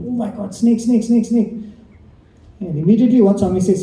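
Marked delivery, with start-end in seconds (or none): 0.1-1.5 high, panicked cries of snake, pitched well up; retold shriek of fright. Driver waking to a snake on his feet, shrieking in fright. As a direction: yelp it out like he just felt the snake. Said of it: "He shrieked".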